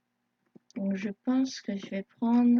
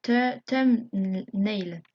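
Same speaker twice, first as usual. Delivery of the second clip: English word with a French accent; halting, loud French-accented English; 6 dB louder, much slower than usual. Real, not acted. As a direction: stumble slowly over thumbnail in a French accent. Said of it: "Désolée, euh, pour mon accent".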